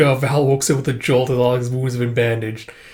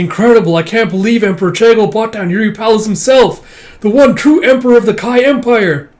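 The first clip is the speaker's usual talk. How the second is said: high, loud, thrilled praise; awed cheer; 9 semitones higher, 8 dB louder than usual. Acted. Soldier to comrades, awed by the emperor, loud excited praise. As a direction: gush with amazement at the emperor.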